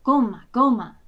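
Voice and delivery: loud booming drum syllables; drum sound. A narrator's reading. Selma beats the drum, loud drum call. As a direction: beat it out with the voice.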